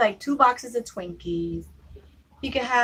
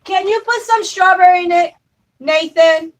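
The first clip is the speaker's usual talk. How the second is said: loud, high call across the house; 8 semitones higher, 12 dB louder than usual. Real, not acted. Calling Nathan in another room to fix the milk, loud.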